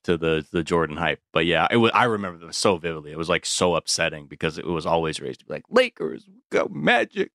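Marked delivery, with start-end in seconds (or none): 5.7-7.3 high cheering chant; childish cheer. Retold or imitated. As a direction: chant it like a kid fan.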